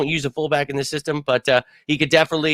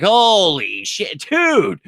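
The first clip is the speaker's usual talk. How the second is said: exasperated outburst; 7 semitones higher, 4 dB louder, much slower than usual. Real, not acted. Exasperated at media questions.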